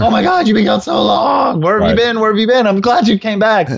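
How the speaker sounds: high, frantic needy dog voice; anxious dog. Acted. Needy terrier greeting his owner after seconds, high frantic voice. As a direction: gush like a panicked pet whose owner just came back. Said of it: "he's all anxious and is like".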